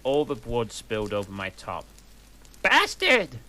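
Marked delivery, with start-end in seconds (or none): 2.6-3.4 sudden high, loud curse; angry shout. Acted. Goth girl yelling at the boy who bumped her, furious. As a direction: spit the insult out at full volume. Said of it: "I shouted angrily".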